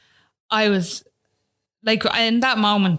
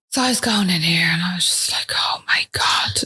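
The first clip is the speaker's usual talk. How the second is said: whisper. Real, not acted. Mother to a friend, reliving the scan room, whispering in dread. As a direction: breathe it out in fear.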